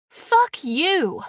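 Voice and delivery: loud, high defiant yell; yelled curse. Acted. Captive shouts at the alien guard, loud and defiant. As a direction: hurl it at him.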